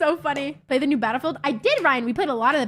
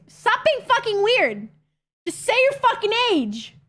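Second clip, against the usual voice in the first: exasperated scolding; 9 semitones higher than usual. Real, not acted. Streamer to a chatter joking about their age, exasperated. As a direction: scold them, pitch jumping up.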